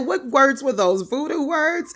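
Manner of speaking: high, incredulous questions; high-pitched retold astonishment; bewildered questioning. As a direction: imitate his amazed demand to know. Acting out his bewildered questioning.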